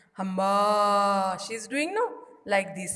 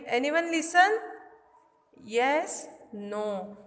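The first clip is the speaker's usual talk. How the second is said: ordinary delivery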